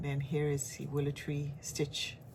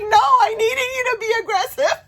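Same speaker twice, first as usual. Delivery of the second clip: very high, loud retold outburst; emphatic protest; 14 dB louder than usual. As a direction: imitate a friend crying out through laughter. Acts out friend's emphatic reply.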